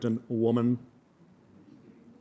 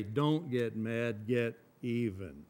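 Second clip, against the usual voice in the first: slow quiet saying; 2 semitones lower, 7 dB quieter, slower than usual. Retold or imitated. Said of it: "they say".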